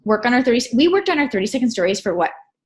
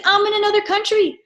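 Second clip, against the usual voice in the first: exasperated dad; 10 semitones higher than usual. Retold or imitated. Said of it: "He's like".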